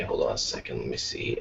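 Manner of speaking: soft whisper to self. Real, not acted. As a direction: mutter it under your breath. Muttering while searching.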